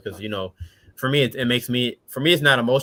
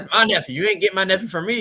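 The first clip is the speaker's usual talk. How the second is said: feisty aunt; 9 semitones higher, 5 dB louder than usual. Retold or imitated. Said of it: "my aunt was like".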